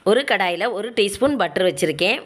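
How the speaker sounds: normal talking voice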